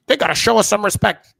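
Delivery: loud mock indignation; mock Cole. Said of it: "Cole still".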